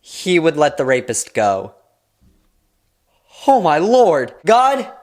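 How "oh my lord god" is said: exasperated. Real, not acted. Exasperated appeal to God.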